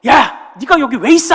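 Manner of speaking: sudden high, loud yell of a senior soldier; retold yell. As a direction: bark it out like an angry sergeant. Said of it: "하고 소리를 지르는 거예요". (화난 선임의 높고 큰 호통)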